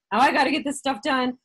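high, loud, pressured rush; stressed busy voice. Pretend. Business owner voicing grind mode, pressured and loud. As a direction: sound like the to-do list is chasing you.

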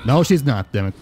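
ordinary delivery

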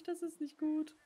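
ordinary delivery

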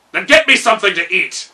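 loud king's order; commanding. A narrator's reading. Gnome king ordering food from his steward, loud command. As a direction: bark it as a royal command. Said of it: "commanded the king".

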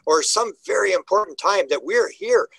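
usual speaking voice

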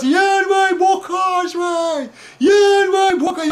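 hammed-up child's bawling plea; tearful begging. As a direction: wail loudly for what you want. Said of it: "plângând să ne iei niște bocanși".

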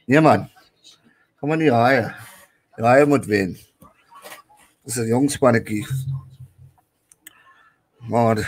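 ordinary talk, nothing marked

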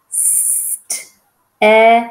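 "Step" pulled apart to expose its vowel; long st, a gap, then a loud short e as in edge; sounded-out word. Shown as a demonstration. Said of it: "I hear e, e, e like edge".

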